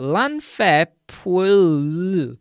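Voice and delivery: PWLL with the final L held very long; loud "Pwll", stretching the L absurdly; drawn-out double L. Shown as a demonstration. Mocking the two L's, over-stretched final L. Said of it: "the two L's there".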